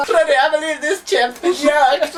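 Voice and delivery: mock posh chap. Joining the posh chap parody.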